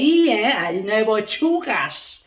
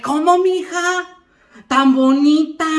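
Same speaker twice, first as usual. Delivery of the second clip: high, slow, stunned question; surprised and shocked; 4 semitones higher, 3 dB louder than usual. Acted. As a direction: gasp at the news with a pitying tone. (sorprendida y escandalizada)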